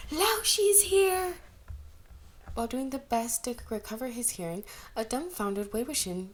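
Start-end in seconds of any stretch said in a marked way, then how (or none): 0.1-1.3 very high, loud, slow ringing yell; girl announcing the teacher at the top of her lungs; shout. A narrator's reading. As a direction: scream it to the whole street. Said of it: "with a shout of".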